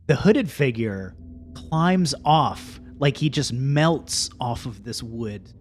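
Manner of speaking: ordinary talk, nothing marked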